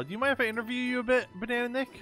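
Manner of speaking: ordinary delivery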